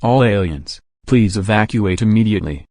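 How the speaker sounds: ordinary talk, nothing marked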